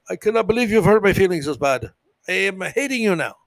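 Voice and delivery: hurt friend in a bad Indian accent. Offended Romanian friend scolding you, in a mistaken Indian accent. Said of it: "Why did I use an Indian accent".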